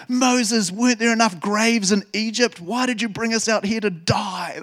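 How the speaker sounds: high, hammed-up panicked complaint; dramatic. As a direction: wail at Moses in mock despair, drawing out the end. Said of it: "They're just so dramatic".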